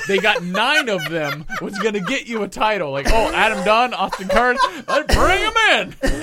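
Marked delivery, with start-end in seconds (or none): 3.1-6.2 higher, louder, gleeful front-office voice; mock eagerness. Mocking the team signing right fielders, hammed-up eagerness.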